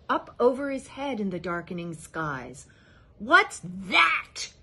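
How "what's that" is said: loud scornful snort of a question. A narrator's reading. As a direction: scoff at the moon. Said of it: "snorted Yertle".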